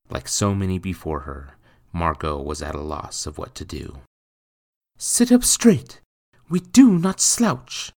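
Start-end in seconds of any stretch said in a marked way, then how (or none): 5.0-7.9 much higher, slow, prim scolding; chiding mother. Acted. Mother scolding her son's posture, high and prim. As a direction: correct his posture like a strict mother. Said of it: "Margot chided".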